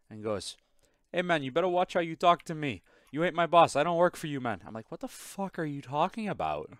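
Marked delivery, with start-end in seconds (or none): 1.1-4.6 high-pitched, heated imitation of a Jamaican accent; Jamaican accent impression. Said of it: "me doing my best impression of what he said". 4.9-6.7 higher-pitched retold bafflement; incredulous comeback. Retells his baffled reply to coworker.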